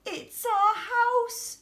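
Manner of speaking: high, slow squeaky mouse voice; a slow, high little mouse squeak. A narrator's reading. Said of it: "squeaked the mother mouse".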